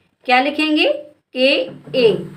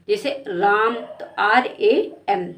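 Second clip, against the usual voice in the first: ordinary talk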